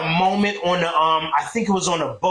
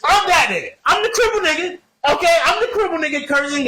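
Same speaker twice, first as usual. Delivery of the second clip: raised, louder, higher voice; excited; 10 semitones higher, 4 dB louder than usual. Real, not acted. Telling the podcast he's the one cursing God, excited. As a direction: proudly claim it. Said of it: "excited self-identification".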